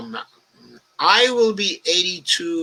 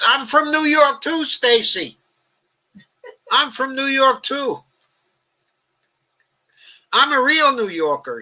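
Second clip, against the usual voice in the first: much higher and louder, mock-indignant protest; indignant outburst; 12 semitones higher, 6 dB louder than usual. Real, not acted. Host protesting to Stacy that he's a New Yorker, high indignant shout. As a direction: throw it back at her, outraged and loud.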